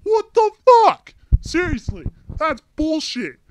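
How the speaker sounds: high, loud, slow disbelieving yell; outraged shout. Real, not acted. Venting to viewers about being sent home, furious high shout. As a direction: blow up at the absurdity, stretching every word.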